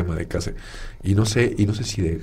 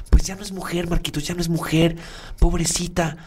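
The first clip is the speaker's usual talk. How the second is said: much higher, insistent lady voice; housekeeper insisting; 7 semitones higher than usual. Retold or imitated. Housekeeper insists to Marcos, high and fretful. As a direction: pitch it up like a fretting older woman.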